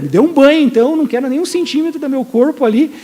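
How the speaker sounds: loud, high, over-eager plea; exaggerated eagerness. Peter asking Jesus for a whole bath, exaggeratedly eager. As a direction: beg for it all, overdoing it. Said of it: "Ele já exagera tudo".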